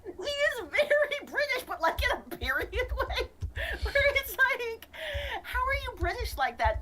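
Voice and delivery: can't stop giggling at the accent; laughing. Real, not acted.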